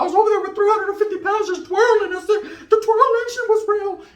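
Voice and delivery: high, laughing falsetto; hammed-up high giggly voice; mock falsetto brag. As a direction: squeal it with giggles. Comic bragging about twirling.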